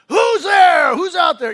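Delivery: full-force high shout at an intruder; reenacted yell. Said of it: "and I yell".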